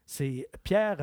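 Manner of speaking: ordinary delivery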